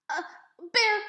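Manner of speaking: high, loud, shaky terrified gasp of a word; a panicked old lady crying out from the floor; frightened old woman. Acted. Old librarian frightened by bear.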